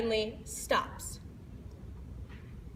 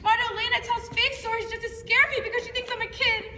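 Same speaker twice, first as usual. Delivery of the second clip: put-on high, quick kid's complaint; dismissive kid; 10 semitones higher, faster than usual. Kid waves off Marta Elena's fake stories, dismissive. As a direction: rattle it off high-pitched, brushing it away.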